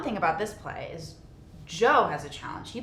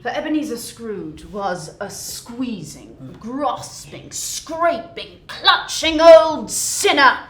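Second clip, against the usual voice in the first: rising to a loud, higher, slow climax; dramatic storyteller crescendo; building narration; 3 semitones higher, 10 dB louder, much slower than usual. A narrator's reading. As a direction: pile on each word louder.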